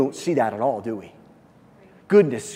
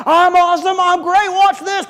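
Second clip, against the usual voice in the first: much higher, louder bragging call for attention; boastful show-off; 13 semitones higher, 7 dB louder than usual. Retold or imitated. Preacher mocking a show-off, much higher bragging shout. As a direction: crow it like a show-off begging to be watched. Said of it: "Jesus didn't come and say".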